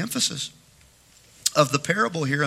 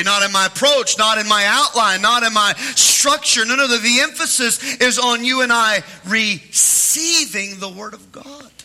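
higher, ringing pulpit delivery; preaching; 7 semitones higher, 3 dB louder than usual. Acted. Pastor praising his church then preaching, ringing delivery. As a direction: lift the church with it.

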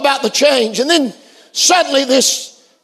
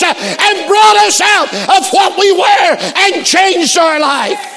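loud, high sermon cry; full-force preaching; 6 semitones higher, 4 dB louder, slower than usual. Real, not acted. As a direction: drive each phrase home like a revival preacher.

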